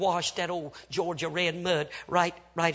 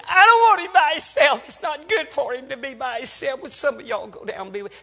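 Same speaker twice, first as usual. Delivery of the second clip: high, loud outburst of a worried wife; acted frantic woman, pitched up; distraught plea; 9 semitones higher, 12 dB louder than usual. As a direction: burst out high, then ease into urgent pleading. Distraught wife pleads for her husband.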